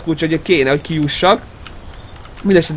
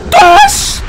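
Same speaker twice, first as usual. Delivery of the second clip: very high loud yelp; sharp shriek; 7 dB louder than usual. Real, not acted. High shout in chase.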